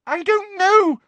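high loud frightened squeal; terrified squeal. Acted. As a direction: squeal it in terror. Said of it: "squealed Hermione, terrified".

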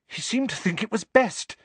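put-on high, breathless girl. A narrator's reading. Hermione answering Harry about Dumbledore, breathless. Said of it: "rather breathlessly".

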